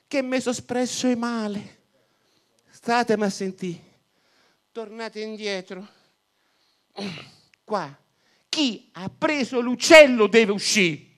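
calling the men back, a little flustered; flustered priest. Acted. Flustered priest corrects himself.

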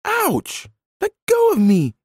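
put-on very high child's squeal of protest; screaming child. A narrator's reading. Child grabbed by an angry man, screaming in a high voice. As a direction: squeal to be let go. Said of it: "screamed the child".

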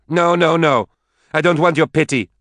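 high, loud, heated protest; angry rejection. A narrator's reading. Daughter rejects her mother's pity, angry. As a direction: push her away with your voice.

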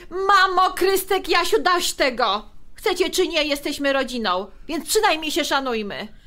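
high, loud call for order; stopping the quarrel. Acted. Krysia cuts off her mother, brother and husband arguing, loud and high. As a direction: shout everyone down to end the fight. Sister stops a family quarrel.

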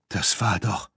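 plain reading voice

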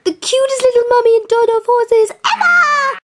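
high, gushing and sing-song. Pretend. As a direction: coo over them like babies. Gushing over horses.

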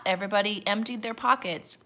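usual speaking voice

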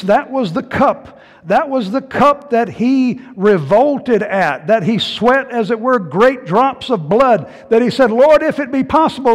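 loud, high-pitched sermon delivery; impassioned preaching. Real, not acted. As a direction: drive it home to the congregation. Preaches at full force.

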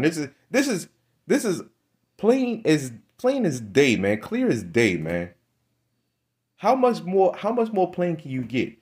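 high, insistent preaching; emphatic preaching. Real, not acted. Preacher insisting to listeners the signs are plain, pitched high. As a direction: hammer home how plain it is.

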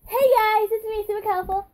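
high little vlogger intro voice; high-pitched character greeting; old high-pitched voice. Shown as a demonstration. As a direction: imitate your own squeakier old voice, pitched well up. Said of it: "I wanna try to do my own voice".

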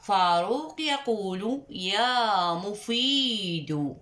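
slow read-along, يا stressed; teacher reading aloud to pupils; slow choral reading. Shown as a demonstration. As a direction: lead the class reading slowly. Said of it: "لنردد معا".

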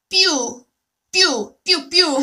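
pew said short and bright, repeated; pew sound demo. Shown as a demonstration. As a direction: show how pew sounds. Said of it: "that's how it sounds".

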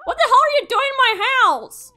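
high, loud indignant yell; outraged shock. Real, not acted. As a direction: yell at the intruder. Another intruder.

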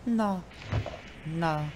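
high, soft, drawn-out no; Consuela. Shown as a demonstration. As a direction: refuse softly and high, like a stubborn maid. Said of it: "this is as close as I can do".

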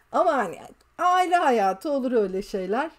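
ordinary delivery

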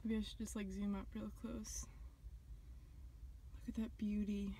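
whisper. Real, not acted. Whispering to camera.

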